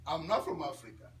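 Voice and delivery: ordinary talk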